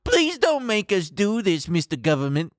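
mock executive pleading with government; whiny executive. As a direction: beg like a spoiled exec. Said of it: "That part's me adding a little color".

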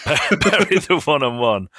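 breaking into a laugh mid-phrase. Real, not acted.

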